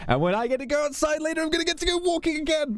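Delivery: high-pitched, hammed-up desperation for a walk; mock rant. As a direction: play stir-crazy in a high squeal. Mock rant about being stuck indoors.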